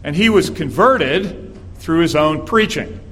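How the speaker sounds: ordinary talk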